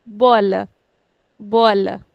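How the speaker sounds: bola with BO stretched, la short; slow, stressing bo in bola; stress on second last. Shown as a demonstration. Teacher models bola to students, stressing bo. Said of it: "We'll put stress on the second last".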